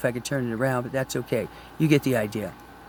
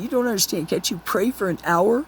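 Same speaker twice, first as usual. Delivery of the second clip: angry Jesus; 6 semitones higher than usual. Retold or imitated. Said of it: "He got so angry at them".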